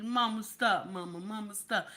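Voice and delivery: low, soft mock protest; lightly voiced embarrassed kid; half-hearted soft plea to mama; bashful son, imitated. Voices son dodging mother's affection.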